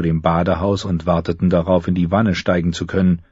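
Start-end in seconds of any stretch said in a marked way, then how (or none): none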